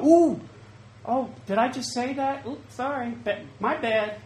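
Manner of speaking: high-pitched sheepish backpedal after a startled ooh; mock oops apology. Preacher acts out someone who just cursed, mock sheepish apology. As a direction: act caught out and hastily sorry.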